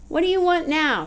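put-upon demand of an unwelcome visitor; irritated demand. A narrator's reading. Shelley confronts the boy at her trailer, irritated.

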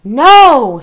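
very loud, high outburst; shouting. A narrator's reading. Rat shouts from the servant girl's pocket, shouting. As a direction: explode in a long protesting shout. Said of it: "shouted Roscuro from Mig's pocket".

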